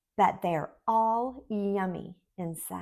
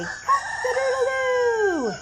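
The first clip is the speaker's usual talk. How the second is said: high, loud rooster call; big morning rooster cock-a-doodle-doo; rooster crow; 4 dB louder than usual. Shown as a demonstration. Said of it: "They say".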